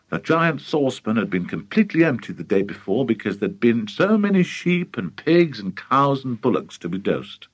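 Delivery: plain reading voice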